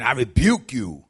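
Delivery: loud, drawn-out rebuke; hard rebuke. Retold or imitated. Preacher recalling his old show of authority, hard rebuke. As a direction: come down hard, slow and loud. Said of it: "authority was me being hard".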